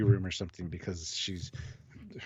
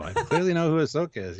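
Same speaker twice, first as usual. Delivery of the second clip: sarcastic; 6 semitones higher, 4 dB louder than usual. Real, not acted. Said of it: "You sound so sarcastic".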